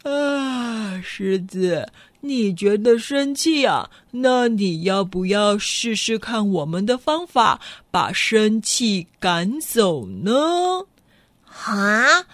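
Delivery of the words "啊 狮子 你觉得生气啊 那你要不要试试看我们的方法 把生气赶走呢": slow, low, unhurried hippo; a lazy hippo offering friendly advice; the hippo invites the lion to try its way of chasing anger off. Acted. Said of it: "河马也说".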